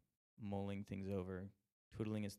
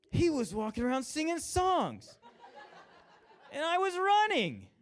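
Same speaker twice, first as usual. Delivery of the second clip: much higher and louder, slow, cracking up through it; laughing mock rant; 10 dB louder than usual. Angry runner complaining about the singer, laughing mock rant. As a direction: rant indignantly while laughing. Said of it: "he starts cussing".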